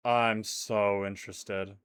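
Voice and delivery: slow, lingering so interested; drawn-out interested. Shown as a demonstration. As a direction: stretch it out with mock awe.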